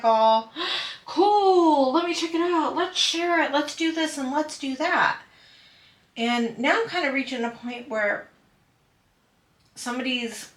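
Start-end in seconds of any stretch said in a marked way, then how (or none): 0.0-5.2 high, loud, eager welcome; excited gushing. Retold or imitated. Past self greeting a newcomer, high and loud. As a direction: gush over a newcomer's channel. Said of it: "I'd be like".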